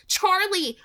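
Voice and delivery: high, gasping cry of a name; a sudden frightened gasp. A narrator's reading. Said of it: "I gasped".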